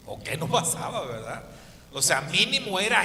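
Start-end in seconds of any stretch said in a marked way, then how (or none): none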